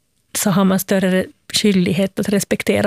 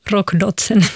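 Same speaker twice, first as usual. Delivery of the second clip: ordinary talk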